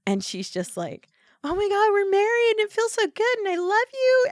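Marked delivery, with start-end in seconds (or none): 1.4-4.3 very high, loud imitated squeal of happiness; mock gushing joy. Imitating bride just married to her sweetheart, gushing. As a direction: gush like a giddy newlywed, hammed up. Newlywed gushes in retelling.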